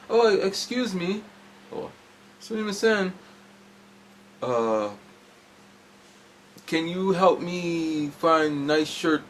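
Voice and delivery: high, slow, careful broken English; faux foreign tourist. Acting foreign to the clerk.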